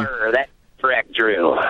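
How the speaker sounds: low growly pirate voice, mumbled; a muttered pirate growl. Acted. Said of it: "that was my best pirate voice".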